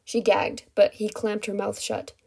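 usual speaking voice

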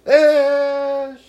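high, loud mocking echo.

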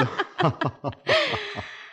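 long high burst of laughter. Acted. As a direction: break into a big laugh.

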